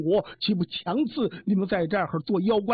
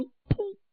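高而短促的一声“噗”; 模仿张嘴喷出法力的声音; 喷气声; 13 semitones higher, 4 dB quieter than usual. A narrator's reading. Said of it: "一欲用法力把嘴这么一张". (噗地一口喷出)

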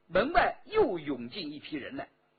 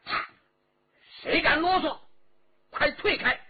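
loud, high warning shout; guard threatening with her sword; furious threat; 10 semitones higher, 6 dB louder than usual. A narrator's reading. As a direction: make them back off.

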